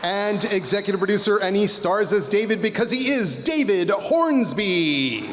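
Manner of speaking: pro wrestler announcer voice. Pretend. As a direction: hype each name like a wrestling event. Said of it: "I'm loving my pro wrestler announcer voice".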